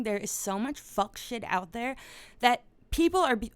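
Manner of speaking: ordinary talk, nothing marked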